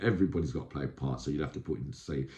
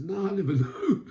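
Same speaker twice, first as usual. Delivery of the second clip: higher, breaking into a laugh mid-line; laughing denial; 13 semitones higher, 4 dB louder than usual. Retold or imitated. Telling the beaten man he never knew, laughing. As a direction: fake innocence that cracks into laughter.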